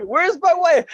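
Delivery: high, slow, loud call for his wife; hammed-up fighter shout. Scene: fighter on mic after win shouting for wife, hammy. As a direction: bellow it like a wrestler after the win. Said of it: "And he's like".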